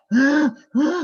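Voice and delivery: high, loud imitated whimpering cry of a baby. Shown as a demonstration. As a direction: mimic the baby's sobbing "heh, heh". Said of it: "gitu kan". (tiruan tangis bayi yang keras dan tinggi)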